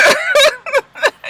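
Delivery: a high burst of laughter. Real, not acted. Laughing at her own joke about the councilman, a high cackle.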